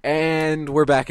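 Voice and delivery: drawn-out, loud host voice; showman announcement. Acted. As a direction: welcome the audience back with flair. Host re-opens the show.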